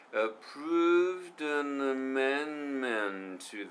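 very slow, "Approved an amendment" with the d linked into "an"; slow linked pronunciation. Shown as a demonstration. Teacher slowly modelling linked English for learners. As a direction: draw out "Approved an amendment", letting each sound flow into the next. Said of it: "approved an amendmentじゃなくて".

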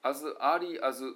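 as early as said word by word, slowly; Japanese-style chopped. Shown as a demonstration. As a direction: chop "As early as" into separate Japanese-style words. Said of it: "As early asって よう言わはるんですよね、日本人の人はね".

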